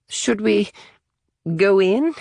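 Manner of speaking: high, trembling and scared; a frightened kid, voice shaking; quavering voice. A narrator's reading. Natalie fearful at the dark chamber, quavering high. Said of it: "in a quavering voice".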